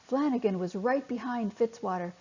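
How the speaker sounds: ordinary delivery, nothing marked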